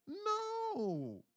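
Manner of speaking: high, sarcastic one-word answer. Pretend. Streamer mocking a viewer's question with a high sarcastic no. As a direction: scoff the no way up high. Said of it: "in a sarcastic way".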